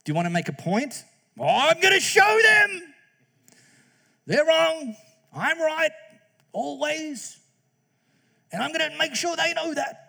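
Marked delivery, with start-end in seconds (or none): 1.4-10.0 high, loud, slow smug shout; hammed-up know-it-all voice; mock self-righteous arguer. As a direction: lampoon the know-it-all who must win.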